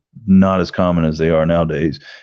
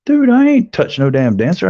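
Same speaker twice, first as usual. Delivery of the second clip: outraged denial; 12 semitones higher, 3 dB louder than usual. Retold or imitated. Denying bouncer's accusation, outraged.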